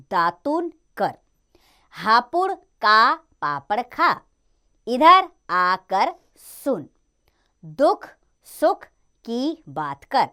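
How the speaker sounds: slow, even reading of practice sentences; slow dictation reading. Shown as a demonstration. Teacher to children reading sentences aloud, slow dictation. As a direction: read each line slowly for the class.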